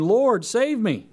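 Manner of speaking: a sinking man pleading for rescue; Peter's cry. Acted. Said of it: "he cried out".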